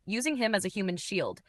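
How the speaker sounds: ordinary delivery, nothing marked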